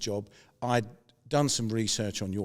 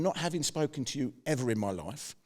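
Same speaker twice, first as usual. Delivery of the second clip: ordinary talk, nothing marked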